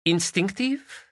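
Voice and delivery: put-on high, accented Spanish woman's voice; Spanish lady. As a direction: voice her as a lilting Spanish lady.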